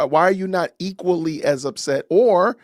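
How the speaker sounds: ordinary delivery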